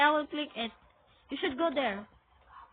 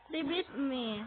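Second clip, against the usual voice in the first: ordinary delivery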